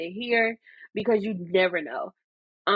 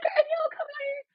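much higher, fast, shouted plea; exasperated yell; faster than usual. Retold or imitated. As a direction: shout at mom to get out here now, half crying. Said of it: "I'm like".

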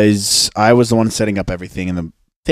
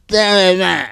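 high, slow, whiny mock of a rude girl; mocking drunk girl; 9 semitones higher, 4 dB louder, slower than usual. Mimicking the rude girl on the beach, whiny and high. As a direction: drag it out like a snotty drunk girl. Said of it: "And she's like".

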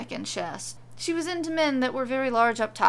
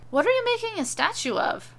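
higher, curious husband voice; husband asking; 12 semitones higher, 4 dB louder than usual. Acted. Husband curious about wife's potato statue, higher voice. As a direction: ask it like a puzzled big man.

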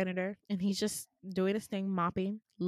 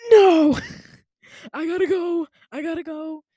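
loud, high, laughing panicked protest; hammed-up panic, trying to get away from the doctor; mock panic; 7 semitones higher, 13 dB louder than usual. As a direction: blurt it like you need to flee.

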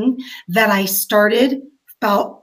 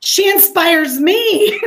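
slow, higher, laughing through tears; real emotional laugh, drawn out and pitched up; tearful laugh; 7 semitones higher, 4 dB louder, much slower than usual. Real, not acted. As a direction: laugh it out while fighting back tears. Moved by a dying friend's message.

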